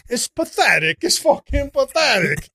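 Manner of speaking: high, loud contempt; scornful disgust. Real, not acted. Comic dismissing rich Manhattan girls, loudly scornful.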